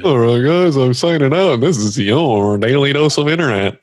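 online video host. Acted. Host signing off to viewers, high lilting imitation. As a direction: imitate a lilting YouTuber outro. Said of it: "Yeah, that guy".

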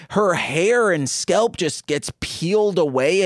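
ordinary talk

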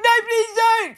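high, loud mock plea; hammed-up desperate begging; mock pleading. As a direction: beg in a high, hammed-up wail. Retold pleading, super attached.

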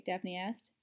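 ordinary delivery, nothing marked

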